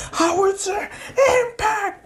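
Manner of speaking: very high, strained move shout; mock anime battle yell; acted attack call. Bakugo calls his special attack.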